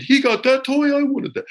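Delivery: high-pitched mock child's whine; jealous kid. Storyteller mimicking childhood envy, high jealous-kid voice. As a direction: imitate a kid envying a toy.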